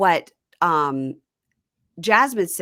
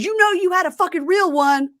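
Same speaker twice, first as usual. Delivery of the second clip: mock parting shot; 13 semitones higher, 5 dB louder than usual.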